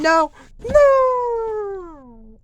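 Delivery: high, loud shrieking no; panicked wail. Pretend. Villain caught in the jar, high panicked wail. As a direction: wail as she gets trapped.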